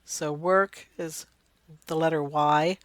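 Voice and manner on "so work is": ordinary talk, nothing marked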